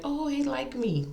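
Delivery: lightly imitated lovestruck tone; mock smitten self. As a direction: play the gullible girl she was. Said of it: "I'm over here like".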